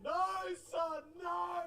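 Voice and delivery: hammed-up father crying out to his son; anguished shout. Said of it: "'cause you're like".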